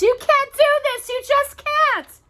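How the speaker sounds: very high, loud desperate plea; begging. Acted. Cinderella locked in, begging her stepmother. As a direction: beg to be let out. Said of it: "Cinderella begged".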